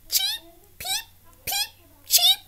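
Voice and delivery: very high tiny chirping chick voice; chick peeps. A narrator's reading. Reader voicing hatching chicks for children. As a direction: peep like a newborn chick.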